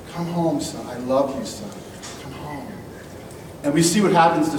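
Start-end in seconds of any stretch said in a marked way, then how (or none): 0.1-2.7 soft, tender call home; compassionate father. Shown as a demonstration. What the father did say, softly and tenderly. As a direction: gently call the lost son home.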